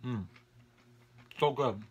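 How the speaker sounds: talking while chewing; savoring a bite; mouth full, chewing. Real, not acted. Seen 2: Bite at -4, closed-mouth chewing, eyes shut, then speaks.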